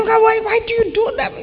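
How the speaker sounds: a hurt member protesting to the shepherd; protesting church member. Retold or imitated. Member protesting to the shepherd who shouted, high indignant question. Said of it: "But you say".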